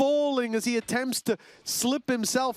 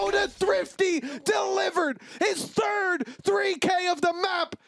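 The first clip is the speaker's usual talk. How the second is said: raised, excited play-by-play; esports caster; 9 semitones higher, slower than usual. Real, not acted. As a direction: call the triple kill with hype. Caster calls a triple kill.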